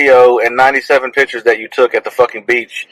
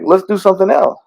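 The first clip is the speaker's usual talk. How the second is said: normal talking voice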